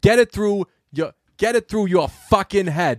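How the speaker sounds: hammed-up Boston accent, dropped Rs; Boston accent attempt. Shown as a demonstration. Retrying the line after a co-host, Boston accent. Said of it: "Try to do that line".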